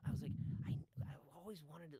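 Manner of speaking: normal talking voice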